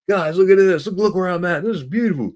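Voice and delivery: high, loud enthusiastic address to viewers; excited vlog call. Retold or imitated. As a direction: show them the view, bursting with excitement. Talking to vlog camera on trail.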